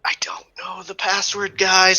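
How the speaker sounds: high, breathy whispered aside; hushed confession to the group; stage whisper. Acted. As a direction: whisper it urgently to your companions. Player whispers aside to the party.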